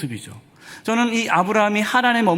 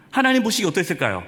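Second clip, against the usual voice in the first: ordinary talk, nothing marked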